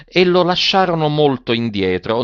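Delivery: plain reading voice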